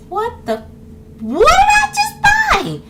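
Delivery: high baffled gasp building to a slow, loud cry; hammed-up buyer's horror; mock disbelief. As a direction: start stunned, end in a high wail. Acted baffled reaction to game.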